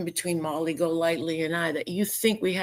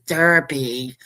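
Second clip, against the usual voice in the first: mock imitation; 4 semitones lower, 4 dB louder than usual. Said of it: "Isn't that how she says it?".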